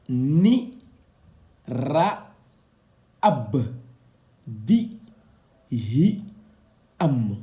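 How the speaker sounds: each Arabic syllable spoken alone, loud and clearly cut; Arabic syllables read out. Shown as a demonstration. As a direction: read the letters one by one like a Quran teacher. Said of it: "avec la voyelle de cercle". (syllabes arabes détachées, fortes et nettes)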